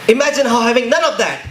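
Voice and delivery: spoken-word poem. Acted. Poet accusing those who want blood, impassioned spoken word. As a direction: perform it as a driving, emphatic protest poem.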